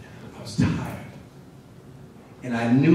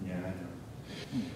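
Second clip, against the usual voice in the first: resigned quiet; 6 semitones lower, 17 dB quieter, much slower than usual. Retold or imitated. Resigned reply to mother.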